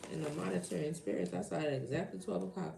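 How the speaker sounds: normal talking voice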